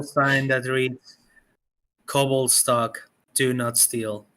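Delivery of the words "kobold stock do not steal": usual speaking voice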